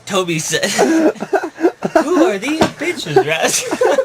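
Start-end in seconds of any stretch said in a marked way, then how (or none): 0.6-4.0 high-pitched, helpless giggle; laughing through line. Acted. Storyteller voicing puppy to his mentor, laughing. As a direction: crack up while delivering the dog's question.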